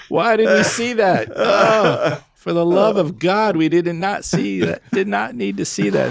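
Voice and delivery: high, drawn-out wail of regret; hammed-up appalled lament; mock horror. Friends fleeing the catheter sight, comically horrified. Retold horror after running out.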